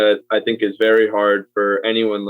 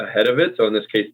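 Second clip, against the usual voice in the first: ordinary talk, nothing marked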